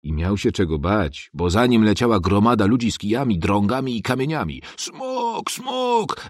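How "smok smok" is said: high shrill-pitched mob cry, falling; panicked crowd shouting dragon; crowd yelling. A narrator's reading. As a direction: yell it like an angry crowd. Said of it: "wrzeszczeli oni".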